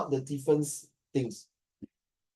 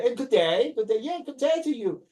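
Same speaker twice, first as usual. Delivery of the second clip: a hint of an Australian "good day", pitched up; lightly Australian; 6 semitones higher than usual. Retelling a Malay man's Australian greeting, a light Australian accent. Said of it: "I think very Australian".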